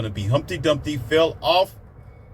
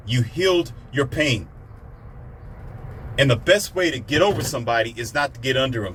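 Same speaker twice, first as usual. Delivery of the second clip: ordinary delivery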